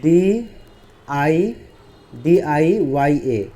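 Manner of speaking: letters spelled out slowly, one by one; slow spelling out. Shown as a demonstration. Teacher spelling out a word letter by letter. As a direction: read each letter slowly and clearly. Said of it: "देखो डी".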